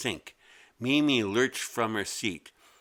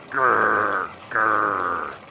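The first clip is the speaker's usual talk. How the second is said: gritty mechanical growl; growly grinding noise; 8 dB louder than usual. Acted. Sharpener grinding.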